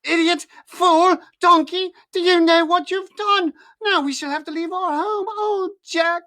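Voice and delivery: high, loud furious screaming; furious mother screams at her foolish son. A narrator's reading. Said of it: "pounding Jack with her hands and screaming".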